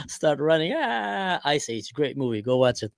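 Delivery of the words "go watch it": normal talking voice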